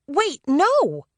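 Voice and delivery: high, loud cry of protest; yell. A narrator's reading. Leela yells at the team to stay, loud and high. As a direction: stop them from leaving, high and loud. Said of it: "Leela yelled".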